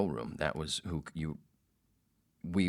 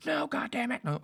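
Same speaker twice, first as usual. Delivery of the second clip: very high mock outburst; screamed denial. Angry host rejects producer's question about the board, screaming. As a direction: snap back in an irate high voice. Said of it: "screaming at me".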